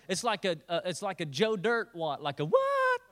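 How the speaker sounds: hammed-up incredulity; incredulous what. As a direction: play it up like a baffled comic king. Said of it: "Kinda like that".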